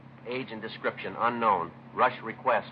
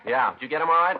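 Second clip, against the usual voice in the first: raised, higher shout to partner; loud call back; 10 semitones higher, 8 dB louder than usual. Acted. Detective answering his partner, loud call. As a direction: call back across the rooms. Partner calling during raid.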